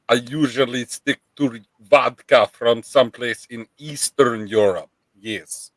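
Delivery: put-on Eastern European accent, muppet-like; thick mock Eastern European accent. Streamer telling co-host about vodka, Eastern European accent. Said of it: "You talk like a muppet".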